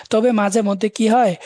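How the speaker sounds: ordinary talk, nothing marked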